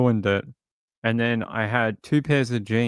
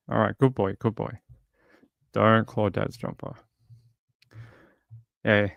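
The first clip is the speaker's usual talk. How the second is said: ordinary delivery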